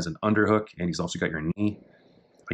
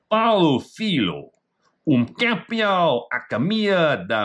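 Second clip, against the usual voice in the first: higher, slow attempt at a Portuguese caption; Portuguese reading; 9 semitones higher, 3 dB louder, slower than usual. Shown as a demonstration. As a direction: sound it out in halting Portuguese.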